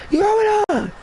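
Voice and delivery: very high mock yell; yelling teacher. Teacher accusing student in the hallway, mock yelling. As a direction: imitate a teacher screeching in falsetto. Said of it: "She yelling at me".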